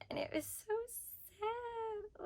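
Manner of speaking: very soft, rising to a high teary squeak; choked up and hushed; tearful. Real, not acted. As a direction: voice thinning away as if about to cry. Moved retelling a sad scene.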